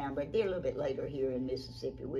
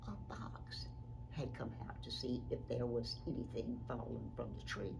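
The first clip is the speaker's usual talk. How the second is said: softer, near-whispered narration; hushed storytelling; 3 semitones lower, 6 dB quieter than usual. A narrator's reading. Storyteller reveals the lurking fox, hushed. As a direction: lower the voice to bring in suspense.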